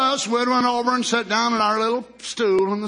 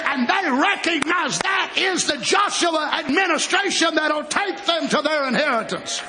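full-force preaching; 6 semitones higher, slower than usual. Real, not acted. Telling the congregation of a new generation, full-force preaching. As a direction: preach it out with hard, rolling emphasis.

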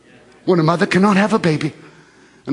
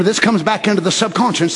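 ordinary talk, nothing marked

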